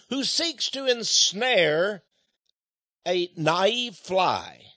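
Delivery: slow, sly. Acted. Sermon on cunning spider.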